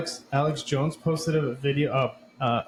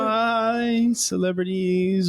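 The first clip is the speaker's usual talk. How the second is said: high, drawn-out singsong goodbye; mock send-off; 6 semitones higher, 3 dB louder, much slower than usual. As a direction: wave them off with a teasing, stretched farewell. Mock send-off to departing celebrities.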